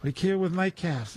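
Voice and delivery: mock Irish boast.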